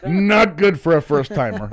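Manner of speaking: loud, high, laughing exclamation. Real, not acted. Telling friends about a bad first time, loud and laughing. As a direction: laugh through the words. Laughing at his own story.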